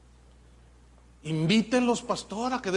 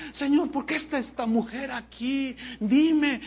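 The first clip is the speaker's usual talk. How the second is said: higher, urgent mock testimony; hammed-up pleading witness; young woman testifying; 4 semitones higher than usual. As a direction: retell hell's horrors like an overwrought girl. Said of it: "una joven y dice".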